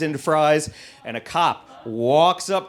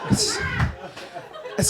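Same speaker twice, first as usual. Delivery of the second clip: squeaky laugh; 5 dB quieter than usual. Real, not acted. Laughing over trauma story.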